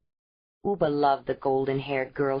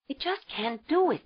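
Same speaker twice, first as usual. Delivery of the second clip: put-on higher young woman's voice, pleading; desperate young mother; 9 semitones higher than usual. A narrator's reading. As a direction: beg to keep the baby, close to tears. Ayla refusing.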